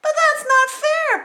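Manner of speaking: desperate protest; crying out princess. Acted. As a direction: wail against the verdict. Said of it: "Paulina cried".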